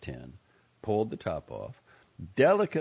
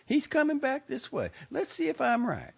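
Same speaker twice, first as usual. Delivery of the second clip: high, louder, eager lady voice; excited; 4 dB louder than usual. A narrator's reading. Lady eager to test her guess on the driver, excited. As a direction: announce it eagerly in a high folksy voice. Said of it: "said in an excited voice".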